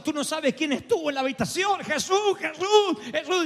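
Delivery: high, rising cries of Jesus; husband breathlessly telling his wife; excited outburst. Retold or imitated. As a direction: burst out the name in awe. Bursting to tell wife about Jesus.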